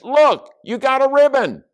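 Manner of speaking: put-on very high, loud kid voice; excited shout. A narrator's reading. Stink spots his sister's ribbon, high excited shout.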